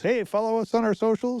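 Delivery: bright high mock call-out to the crowd; mock stage plug. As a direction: toss it off like every band's closing line. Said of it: "same thing that everybody always says".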